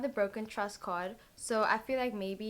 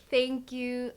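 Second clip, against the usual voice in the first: a sudden loud burst of thank you; overjoyed loud thank you; 3 semitones higher, 7 dB louder than usual. Real, not acted. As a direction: blurt the thanks out loud and grateful. Gushing thanks for 5K subscribers.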